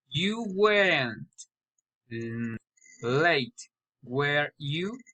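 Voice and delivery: "weren't" falls, "were you" rises; hesitant statement, then lift "were you" sharply; tag question intonation. Shown as a demonstration. Learner practising tag questions, rising on "were you". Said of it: "poly or raising intonation".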